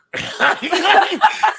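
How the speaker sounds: a loud high cackle; real laughter at the yolk story. Real, not acted.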